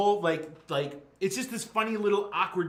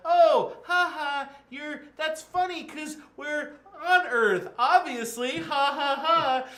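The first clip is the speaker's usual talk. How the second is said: high, loud, forced ha-ha's; hammed-up phony laughing through the words; fake laughter; 12 semitones higher, 7 dB louder, slower than usual. Teens pretend they are on Earth, fake laughing.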